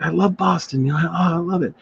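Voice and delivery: high-pitched imitation of an enthusiastic fan; retold gushing. [name] telling a Bostonian of his love, retold as high gushing.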